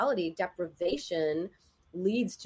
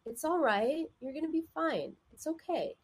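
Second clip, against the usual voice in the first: high, soft, soothing reassurance; kind, encouraging; 7 semitones higher, 4 dB quieter than usual. Shown as a demonstration. Showing how to reassure someone who's struggling, tender and kind. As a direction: comfort gently, pitched up and softened. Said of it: "It has a totally different feeling".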